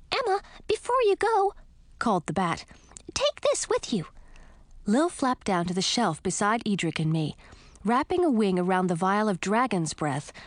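high, lively little bat. Acted. Lil the bat speaks.

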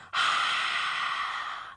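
long breathy crowd-cheer noise; crowd roar. A narrator's reading. Boy faking the crowd after his touchdown, breathy roar. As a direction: imitate a stadium roar on one breath.

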